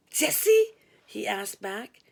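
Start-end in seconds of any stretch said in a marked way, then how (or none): 0.1-0.7 high loud surprise; startled, pitch jumps; surprised. A narrator's reading. Surprised caller.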